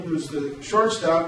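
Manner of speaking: normal talking voice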